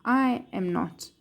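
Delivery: ordinary talk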